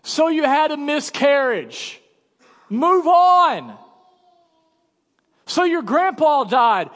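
high, loud, hammed-up impatient brush-off; mocking, scornful and loud, high-pitched; mock dismissive Jesus. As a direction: play a cold Jesus telling you to move on. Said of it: "you think Jesus looks at you and you're like".

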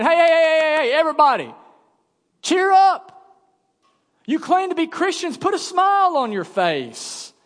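high, loud, hammed-up cheerleader telling mourners to cheer up; over-the-top pep talk, high and loud; mock cheery Jesus. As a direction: play a fake-happy Jesus brushing off grief. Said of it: "Some of you think Jesus is that way".

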